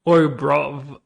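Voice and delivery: loud, hesitant attempt at British slang accent. Shown as a demonstration. Streamer answering a viewer's accent request, British accent. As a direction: try a British "oi, bruv". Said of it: "in a British accent?".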